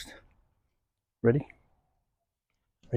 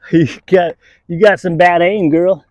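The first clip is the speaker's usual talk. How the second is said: teasing dog; 4 semitones higher, 6 dB louder than usual. Real, not acted.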